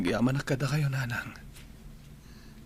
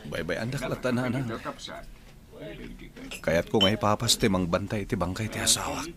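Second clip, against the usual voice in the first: usual speaking voice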